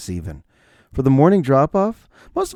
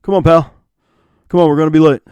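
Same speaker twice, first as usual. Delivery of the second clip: put-on louder, laid-back dad to his kid; cool dad voice; 3 semitones higher, 9 dB louder, faster than usual. Cool dad hurrying son at drop-off, louder casual buddy tone. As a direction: talk to your kid like a buddy. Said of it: "not in a kid-like voice, but just like".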